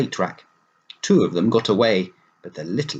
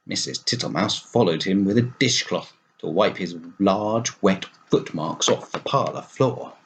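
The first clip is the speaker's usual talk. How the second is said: ordinary delivery, nothing marked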